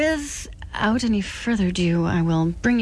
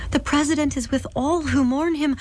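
put-on tender girl voice; 8 semitones higher than usual. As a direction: comfort her gently. Girl comforting the widow.

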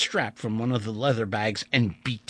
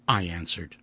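usual speaking voice

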